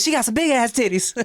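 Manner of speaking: high, light, giggly Michael impression; Michael checking out a fan.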